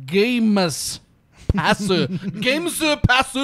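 "Games Pass" with an extra s, laughing; mispronounced Games Pass. Pretend. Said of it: "hard time for saying Games Pass".

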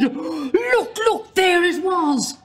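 high shouted call, drawn out; children yelling at the fireworks; excited shout. A narrator's reading. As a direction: shout it to the whole crowd. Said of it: "they all saw it and shouted".

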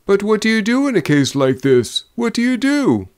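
high, climbing, agitated questions; anxious drummer. A narrator's reading. Drummer pressing the men about this case, anxious. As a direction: press them for an answer, voice rising high.

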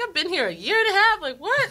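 incredulous. Retold or imitated. Incredulous inner voice quoted.

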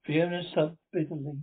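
ordinary delivery, nothing marked

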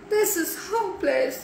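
high-pitched despairing whine; wailing. A narrator's reading. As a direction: wail that all is lost.

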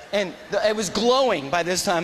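ordinary talk

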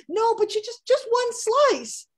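high, loud pushy mother voice; pushy mom. Retold or imitated. Mom pressing cheesecake on her daughter, high and pushy. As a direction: wheedle like a mother who won't take no.

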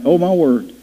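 usual speaking voice

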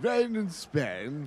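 put-on soft, slow British accent; understated mock British. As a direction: draw it out in quiet British tones. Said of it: "you can all do a British accent".

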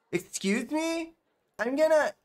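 high put-on girl voice; high-pitched mock teen girl; offended schoolgirl. Voicing offended schoolgirl in game.

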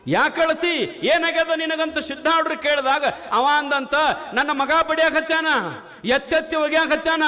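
high, ringing sermon voice; rising pulpit proclamation; fiery preaching. Acted. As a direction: preach it out to the crowd.

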